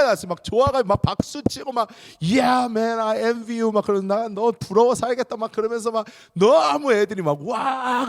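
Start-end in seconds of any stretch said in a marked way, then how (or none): 2.2-3.7 high, loud, drawn-out cheer; excited cheer. Retold or imitated. Boys cheering in English. 4.1-8.1 high and loud envious cheering; excited. Retold or imitated. Boys cheering.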